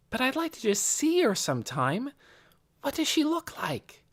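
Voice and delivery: put-on very high, eager child's voice; Nicky, pleading girl. A narrator's reading. Girl asking to meet the prophet, high and pleading. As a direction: press the request in a high young voice.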